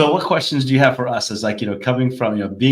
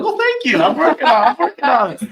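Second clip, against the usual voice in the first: much higher, laughing through it; laughing thanks; 7 dB louder than usual. Retold or imitated. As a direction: giggle out a flattered thank you. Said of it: "I'm like".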